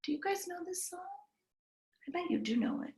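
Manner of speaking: usual speaking voice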